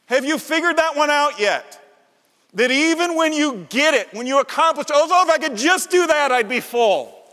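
impassioned sermon voice; full-force preaching. Acted. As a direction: drive it home from the pulpit.

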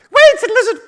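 very high, loud little squeak; small animal voice. Acted. Frog to a hungry shark, high loud squeal. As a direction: squeal urgently in a tiny voice. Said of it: "all my small animals have got the same voice".

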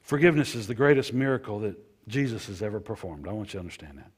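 normal talking voice

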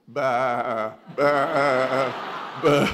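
loud bleating like a sheep. Acted. Lion bleating like a sheep, loud.

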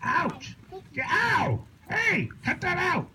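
pained yelp. Real, not acted. Being poked, crying out.

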